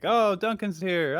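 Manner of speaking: high-pitched, drawn-out giddy squeal of delight; giddy kid thrilled at a visitor, stretching it out; super giddy. Retold or imitated. Younger brother figure greeting an older one, super giddy. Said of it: "he gets super giddy".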